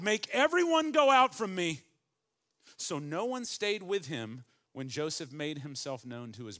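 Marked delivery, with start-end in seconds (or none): 0.0-1.8 loud, high command to clear the room; crying out. A narrator's reading. Joseph crying out to his servants, loud and high. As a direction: cry it out, send everyone away. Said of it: "And he cried".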